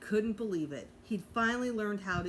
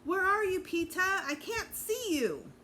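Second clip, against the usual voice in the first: high, loud call across the hall; 11 semitones higher, 4 dB louder than usual. A narrator's reading. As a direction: shout it out into the crowd. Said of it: "Fudge looked out at the audience and called".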